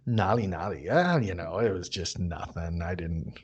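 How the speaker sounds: humble deflection. Retold or imitated. Guest humbly shrugging off praise for a dress, soft and modest. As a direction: wave the compliment away modestly and quietly.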